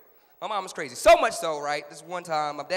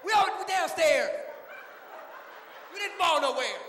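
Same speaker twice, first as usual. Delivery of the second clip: high, loud telling-off; exasperated scolding; 12 semitones higher, 4 dB louder than usual. Acted. Child scolds screaming mom downstairs, high and exasperated. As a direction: yell down at her, fed up.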